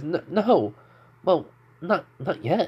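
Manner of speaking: acted embarrassment, haltingly high; flustered denial. A narrator's reading. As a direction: stumble through a mildly flustered denial. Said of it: "her face turns, and Deku's face turns red too".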